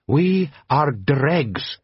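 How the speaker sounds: slow, grave foreign voice; reproachful Jabbar. A narrator's reading. A desert chieftain scolding the watchmen, slow and grave. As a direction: lay down the rebuke slowly. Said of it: "said Jabbar reproachfully".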